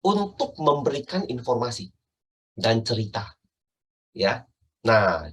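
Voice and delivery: ordinary talk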